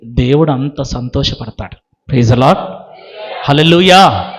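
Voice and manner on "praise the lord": normal talking voice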